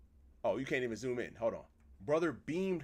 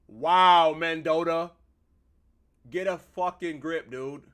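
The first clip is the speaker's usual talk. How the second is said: loud, raised outburst; exasperated scolding; 3 semitones higher, 12 dB louder, slower than usual. Real, not acted. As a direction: call the character out at full volume. Streamer scolds game character.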